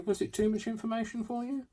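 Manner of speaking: very high, squeaky teasing question; high mock question. Crafter teasing viewers about oversharing, very high mock voice. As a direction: ask it way up in a tiny high voice.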